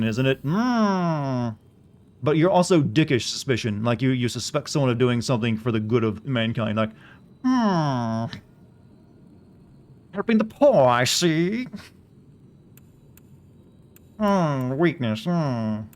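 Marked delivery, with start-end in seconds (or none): 0.4-1.5 drawn-out suspicious hum; suspicious hmm. Pretend. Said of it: "that's the sound of suspicion". 7.4-15.8 high, sly cartoon villain voice; mustache villain. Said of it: "the old-timey, you know, cartoon villain voice".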